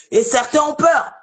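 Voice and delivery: usual speaking voice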